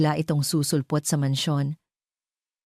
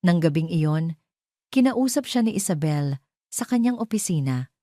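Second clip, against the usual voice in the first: ordinary delivery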